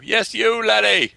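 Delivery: high, loud mock call of laddie; playful high hail. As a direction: call out in a hammed-up voice. Calling out in mock brogue.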